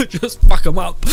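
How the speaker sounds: loud, gleeful shout through laughter; laughing outburst. Real, not acted. Gamer relishing a new spear strategy, laughing loudly. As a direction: burst out laughing as you say it.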